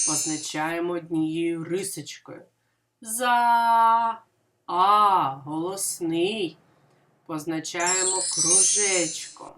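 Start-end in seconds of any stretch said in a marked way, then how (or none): none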